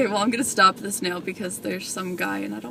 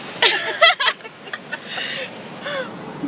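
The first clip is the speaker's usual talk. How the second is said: high squealing giggle; 13 semitones higher than usual. Real, not acted. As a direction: break into giggles. Laughing at the tickle joke.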